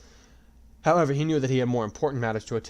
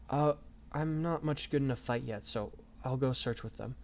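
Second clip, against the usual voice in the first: soft, timid and hushed; quiet; 3 semitones higher, 6 dB quieter than usual. A narrator's reading. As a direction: say it shyly, softly. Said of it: "Sachi said quietly".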